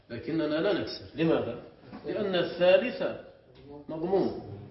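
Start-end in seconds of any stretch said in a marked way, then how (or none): none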